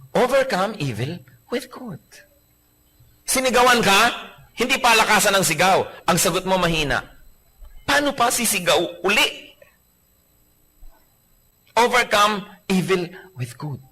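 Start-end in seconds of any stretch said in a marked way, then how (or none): 0.1-2.0 slow reverent repeat. Shown as a demonstration. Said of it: "repeated scripture emphasis". 11.8-13.8 slow reverent repeat. Shown as a demonstration. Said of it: "repeated scripture emphasis".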